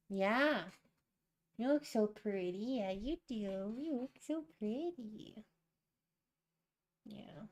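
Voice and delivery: sweet talk a pet kitty; gentle cooing at her cat. Real, not acted.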